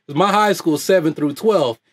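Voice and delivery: usual speaking voice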